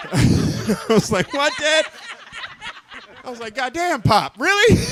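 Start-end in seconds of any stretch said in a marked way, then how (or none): 1.3-1.9 higher, disbelieving what dad; shocked at his father; mock disbelief at dad; incredulous. Reacting to dad. 3.6-4.8 high, disbelieving cry at pop; scandalized by his father; mock shock; incredulous. Reacting to dad.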